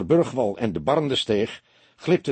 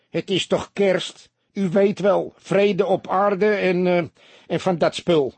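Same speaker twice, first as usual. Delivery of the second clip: high, raised-voice barman's plea; earnest pleading; 7 semitones higher, 4 dB louder than usual. A narrator's reading. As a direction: coax the gentleman insistently, pitched up. (dringend pleidooi, met hoge stem)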